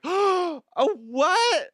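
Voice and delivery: high, loud gasping mock surprise; mock innocent shock. Mocking someone who plays innocent after being rude, high and loud. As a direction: act shocked like you did nothing. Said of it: "then be like".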